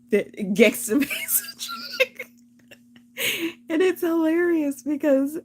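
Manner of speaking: high giggly voice; laughing. Real, not acted. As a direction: laugh through the words. Laughing while describing polish.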